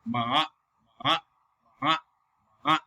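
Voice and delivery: short "ma" with a dip and rise; falling-rising third tone. Shown as a demonstration. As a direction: drop ma down, then bring it up. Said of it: "drop your chin first and then move it up".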